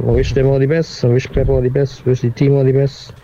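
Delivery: ordinary talk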